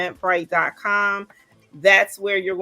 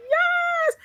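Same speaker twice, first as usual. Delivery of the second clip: a very high, squealing yes; excited cheer. Real, not acted. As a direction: cheer it out like you just won. Cheering during live launch stream.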